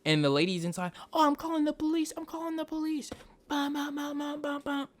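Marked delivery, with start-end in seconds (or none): 1.1-3.1 panicked lady. Retold or imitated. Lady inside calling police. 3.5-4.9 mimicked alarm. Imitating alarm or siren.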